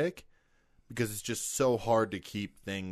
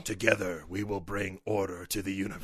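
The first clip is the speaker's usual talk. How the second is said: low haunting voice; 2 semitones lower than usual. Pretend. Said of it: "that's an evil thing".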